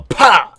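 loud, high smack sound; imitated slap noise. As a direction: punch out an explosive stamp noise. Imitates stamping a passport in game.

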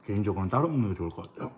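chewing while talking; speaking between bites; mouth full of noodles. Real, not acted. Seen 0: Bit noodles and sausage at -2; lips closed, chewing afterwards.

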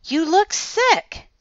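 put-on high, loud, drawn-out girl's voice; slow, high-pitched young girl character; concerned girl. A narrator's reading. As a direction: lift it high and stretch it out. Girl reacts to sick-looking friend.

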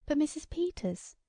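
high-pitched cry of alarm; distressed cry. A narrator's reading. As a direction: cry out urgently to her. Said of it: "cried Missus Hale".

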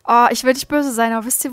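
ordinary talk

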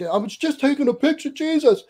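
high, exasperated whine; husband protests to his wife, high and exasperated. Retold or imitated. Husband chases after his wife.

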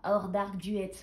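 English title with a French accent; self-mocking French-accented English. Real, not acted. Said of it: "Mon accent anglais".

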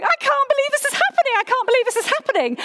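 high, fast, breathless excitement; a rush of thrilled, quick words; giddy disbelief. Retold or imitated. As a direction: bounce around squealing with disbelief. Giddy rush when lecturer is absent.

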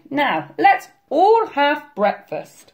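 high, slow granny voice; jolly Granny rounding off the tale; merry granny. A narrator's reading. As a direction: announce it cheerily, drawn out. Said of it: "laughed Granny".

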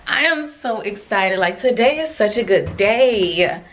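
can't contain the thrill; giddy excitement. Real, not acted.

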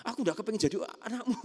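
put-on high, fast child's protest; acted high-pitched rebellion; pressured child. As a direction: blurt it out like a cornered child. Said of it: "anak itu jadi tertekan".

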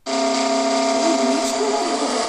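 loud annoyed shout over noise; frustrated yell. Real, not acted. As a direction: yell over the blaring game sound. Car sounds blaring, yells in frustration.

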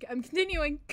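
loud, mock-determined I'm continuing; loud exasperated push on. Real, not acted. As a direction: force yourself back to reading. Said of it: "I'm, I'm gonna".

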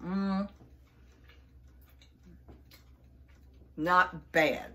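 chewing while talking; mouth full. Real, not acted. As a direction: food in cheeks. Seen 1: Cheeks bulge, jaw working after biting bar; bar held at 4.2.

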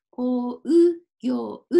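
こう、ぎょう broken into ko, u, gyo, u; spelled-out u. Shown as a demonstration. Teacher dictating the written form, こう、ぎょう with the u sounds separated. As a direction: sound every う in こう、ぎょう as its own syllable. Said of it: "うと書かなければいけません".

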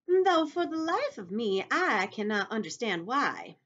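high, loud, slow ladybug voice. Acted. Ladybug talking to James about centipede, high and drawn-out.

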